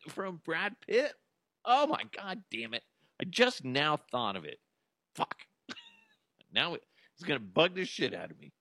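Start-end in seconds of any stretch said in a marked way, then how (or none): none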